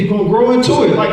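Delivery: ordinary talk, nothing marked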